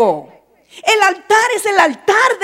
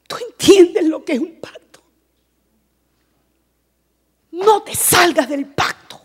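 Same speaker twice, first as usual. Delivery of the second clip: ordinary delivery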